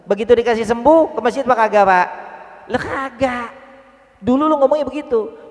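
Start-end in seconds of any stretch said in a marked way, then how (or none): 2.7-5.4 high, sneering put-down; mock the broken promise from up high; higher sneering answer; scornful retort.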